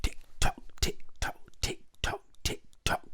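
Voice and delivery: voiceless, evenly spaced ticks and tocks; whispered clockwork; ticking clock. Acted. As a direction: tick like a clock, steady and unvoiced. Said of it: "went the living room clock".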